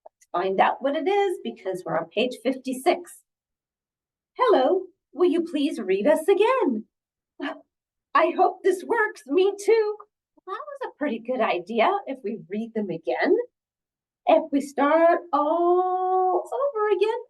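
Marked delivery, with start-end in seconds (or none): 4.4-9.9 high, eager character voice; hopeful storybook characters. Acted. Piggy and Elephant ask to be read again, hopeful. 14.3-17.2 high, slow, singsong stretch; drawn-out story reading. Acted. Reader suggests starting the book over, drawn-out. Reader draws out restart idea.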